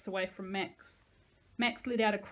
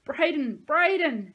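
a hint of sobbing in loud, high calls; sobbing cry; 7 semitones higher, 11 dB louder, slower than usual. A narrator's reading. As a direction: call out through tears. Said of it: "she sobbed".

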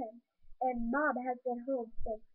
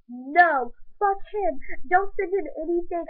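crying anger; 5 semitones higher, 5 dB louder than usual. Acted. Angry daughter defends crying mom.

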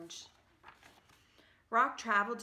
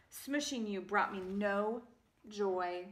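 plain reading voice

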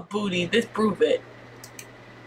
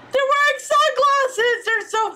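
delighted squeal; 12 semitones higher than usual. Real, not acted. Gamer squealing over dogs in sunglasses.

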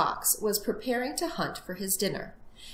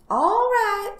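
high, loud piglet whine; exaggerated reluctant piglet; sighing resignation; 13 semitones higher, 8 dB louder than usual. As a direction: give in with a big sigh. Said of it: "sighed the piglet".

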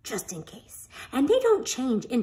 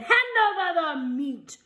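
pirate demand; 6 semitones higher than usual. A narrator's reading. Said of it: "Braidbeard gulped his food and said".

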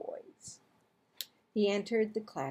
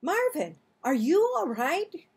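concerned call; 7 semitones higher, 4 dB louder than usual. A narrator's reading. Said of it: "called Missus North".

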